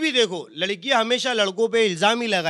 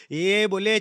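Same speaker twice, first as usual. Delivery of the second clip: ordinary talk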